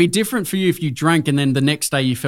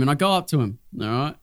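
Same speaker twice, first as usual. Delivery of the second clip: ordinary talk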